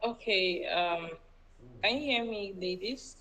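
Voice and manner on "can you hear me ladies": normal talking voice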